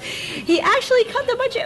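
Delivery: high-pitched hammed-up disbelief; mock amazement. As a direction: sound floored that "actually" happened. Repeats fact in mock amazement.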